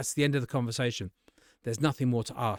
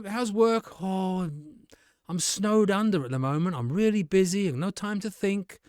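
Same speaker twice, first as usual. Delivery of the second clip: much higher, breezy banter; casual mates chatting; 6 semitones higher than usual. Shown as a demonstration. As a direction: toss it off like greeting old friends. Friend chat continues.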